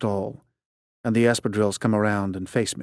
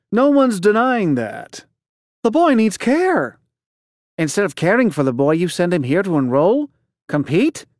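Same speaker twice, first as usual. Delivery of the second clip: scolding dean; 8 semitones higher, 3 dB louder than usual. Acted. Dean scolding the headmaster for neglecting the boy, indignant. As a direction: lecture him on the boy's needs.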